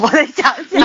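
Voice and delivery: giggling while speaking; laughing. Real, not acted. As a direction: laugh through it.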